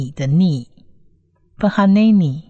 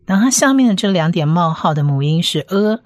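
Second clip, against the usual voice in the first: normal talking voice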